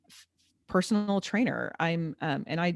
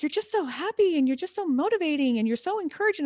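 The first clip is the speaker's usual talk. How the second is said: high, singsong gushing; imitating gushing clients; 8 semitones higher than usual. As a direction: quote admirers in a higher, effusive voice. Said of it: "people who are like".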